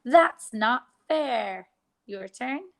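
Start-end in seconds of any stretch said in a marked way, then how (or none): 0.0-1.7 "That's not fair." slow and louder for repeating; slow louder sentence. Shown as a demonstration. Teacher leading the class chant, slow and loud. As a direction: model it slowly and loudly. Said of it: "let's say the sentence".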